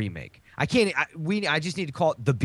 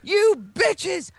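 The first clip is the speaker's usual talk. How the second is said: much higher, shrieking mock insult; crazed movie yell; 3 dB louder, much slower than usual. Retelling Cage's scene, a high hammed-up shriek. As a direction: screech it like a madman. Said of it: "He says".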